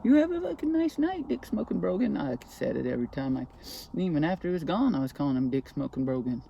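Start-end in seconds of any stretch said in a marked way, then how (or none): 0.0-2.2 high sing-song taunt, pitched way up; a mock-friendly jeer with a sneer; mocking greeting. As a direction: sweetly needle the guy you despise. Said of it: "I'm like".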